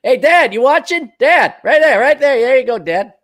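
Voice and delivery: put-on high, eager kid voice calling out; mock child calling dad. A boy calling his dad to watch, in a high eager voice.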